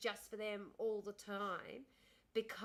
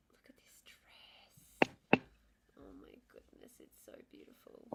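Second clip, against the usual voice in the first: whisper; 4 semitones higher than usual. Real, not acted. Whispering to her co-host about a beautiful dress.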